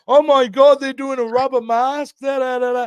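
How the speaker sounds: high, loud imitation of panicking fans; mock freak-out. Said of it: "freaking out going".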